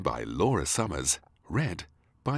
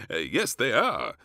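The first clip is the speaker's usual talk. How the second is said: lightly voiced king agreeing; 6 semitones higher, 6 dB louder, slower than usual. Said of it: "he said".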